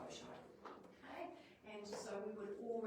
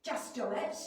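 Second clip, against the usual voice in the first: punchy, louder order; forceful command; 3 semitones higher, 8 dB louder than usual. Shown as a demonstration. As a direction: snap it as an order. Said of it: "to get the essence of which one is".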